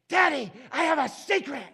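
put-on high, loud little-kid stage whisper gone wrong; imitating a daughter's loud, high "secret"; failed secret voice. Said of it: "she didn't have a good secret voice".

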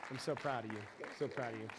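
softly choked up with pride; tender, near tears; proud tears. Real, not acted. Hugging daughter after her talk.

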